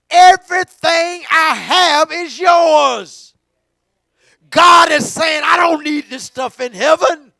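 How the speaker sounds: slow, loud, shouted preaching; preaching at full force. Acted. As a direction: lean on every word, slow and loud. Preached climax.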